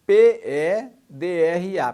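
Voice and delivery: "P E D R A" letter by letter, grouped by syllable; spelled out. Shown as a demonstration. Teacher breaking a word into syllables, spelling "P E D R A" in groups. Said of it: "separando a sílaba".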